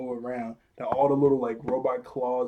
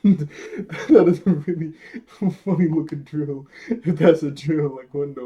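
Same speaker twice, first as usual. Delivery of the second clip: real laughter; 2 semitones higher, 6 dB louder than usual. Real, not acted. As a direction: crack up while repeating the remark. Cracks up at the drill.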